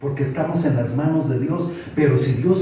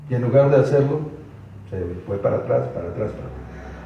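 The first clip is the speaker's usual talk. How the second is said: ordinary delivery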